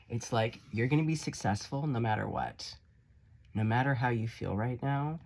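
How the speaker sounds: ordinary talk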